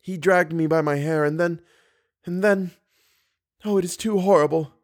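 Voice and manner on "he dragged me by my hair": plain reading voice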